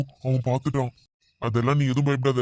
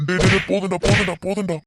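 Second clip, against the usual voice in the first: higher-pitched, repeated plea; pained begging; 5 semitones higher than usual. Acted. Kidnapper begging rescuer that it is enough, pained. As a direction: beg for the blows to end. Kidnapper begs beating to stop.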